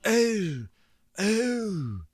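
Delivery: high breathy mock moans; imitating a stew fluffing a pillow seductively; sex pot moan. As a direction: tease it out, hammed up. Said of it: "very sex pot about it".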